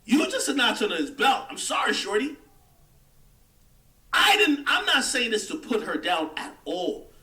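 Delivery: ordinary delivery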